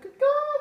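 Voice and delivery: very high, loud crow caw-caw; high piercing mock bird cry; bird call. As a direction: squawk like a crow.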